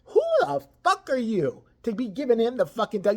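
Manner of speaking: ordinary talk, nothing marked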